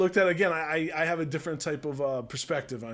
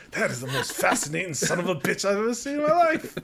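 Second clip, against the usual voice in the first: Al Pacino impression; 3 semitones higher, 3 dB louder than usual. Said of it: "I can't, I can't do the, the voices".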